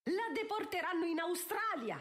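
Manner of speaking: high-pitched vindictive cry. A narrator's reading. Countess pointing at Sophie, crying out. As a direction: call it out high as a threat. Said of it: "gridò Araminta".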